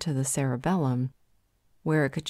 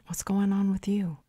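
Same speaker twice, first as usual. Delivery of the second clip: whisper; 5 semitones higher than usual. Acted. Asking her mate a private question.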